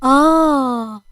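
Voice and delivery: long drawn-out "ah" of realisation; surprised realisation. A narrator's reading. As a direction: let the penny drop on one sound. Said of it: "وبص لزياد باستغراب".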